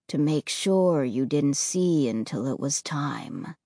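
low, quiet heavy voice; sorrowful ghost boy, low and soft. Acted.

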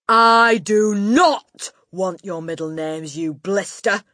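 bellowed insult. A narrator's reading. Headmistress rebuking a cheeky boy, bellowed. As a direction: bellow it word by word. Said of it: "the Gorgon bellowed".

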